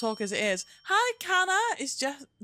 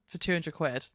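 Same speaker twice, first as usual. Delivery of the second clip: ordinary talk, nothing marked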